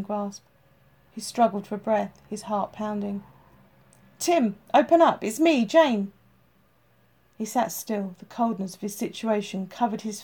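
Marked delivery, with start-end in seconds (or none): 4.2-6.1 loud, higher shout through a door. Acted. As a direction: call out urgently through the door. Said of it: "she shouted again".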